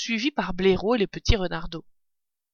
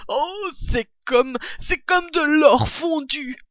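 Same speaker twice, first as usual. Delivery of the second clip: slow, high, awestruck marvelling; breathed-out rapture; 7 semitones higher, slower than usual. A narrator's reading. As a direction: savour each word in wonder. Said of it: "soufflait-il". (émerveillement, voix haute et lente)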